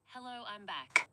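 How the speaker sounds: ordinary talk, nothing marked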